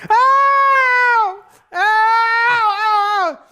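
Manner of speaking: very high loud yelps; repeated shrieked ow; pained yelps. Real, not acted. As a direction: yelp at each bite. Dog keeps biting.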